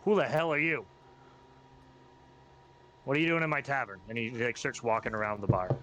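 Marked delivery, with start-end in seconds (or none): 0.0-4.0 annoyed goblin. Acted. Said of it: "he goes".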